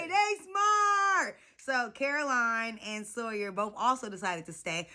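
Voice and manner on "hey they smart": high, slow hollered praise; drawn-out cheer. Real, not acted. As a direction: stretch it out like a victory shout.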